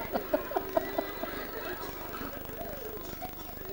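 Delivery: a light breathy laugh, up high; high soft laugh. Real, not acted. Speaker amused by the scene with the crying boy, high soft laugh.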